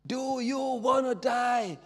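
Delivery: very high, slow, all-out shout of the frontman; full scream. Retold or imitated. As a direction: scream it at the crowd for the third time. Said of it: "der Typ geschrien hat". (voller, hoher Schrei des Sängers an die Menge)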